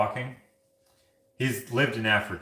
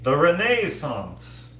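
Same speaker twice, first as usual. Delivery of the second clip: RENAISSANCE drawn out, louder and higher; grand mock pronouncement; 5 semitones higher, 5 dB louder, much slower than usual. Repeating a viewer's name for someone, RENAISSANCE drawn out grandly.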